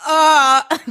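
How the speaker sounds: high loud moaning grunt; imitating her own moan in the cinema; pleasure moan.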